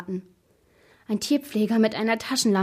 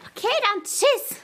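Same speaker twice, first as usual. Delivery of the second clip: very high, loud goodbye call; squeaky call; 5 dB louder than usual. A narrator's reading. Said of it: "rief die Stimme". (laut und piepsig gerufen)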